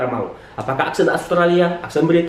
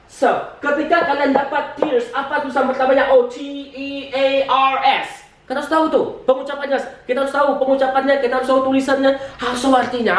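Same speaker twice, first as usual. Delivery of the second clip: rapid-fire teacher, higher pitched; speaking too fast; 5 semitones higher than usual. Real, not acted. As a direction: rattle through the list in a rush. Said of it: "I speak faster with you". (bicara cepat dan bersemangat)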